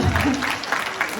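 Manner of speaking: short breathy laugh at her own sass; amused laugh. Real, not acted. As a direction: laugh it off lightly.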